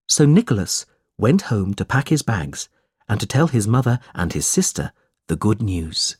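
ordinary delivery

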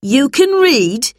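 high, loud, drawn-out disbelief; surprised. A narrator's reading. Teacher learns the girl reads, loudly surprised. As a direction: question it slowly, rising in astonishment. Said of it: "said Missus Bleak in surprise".

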